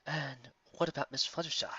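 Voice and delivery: the stag lord asking softly; quiet stag. A narrator's reading. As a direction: ask gently. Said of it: "Whiteheart asked".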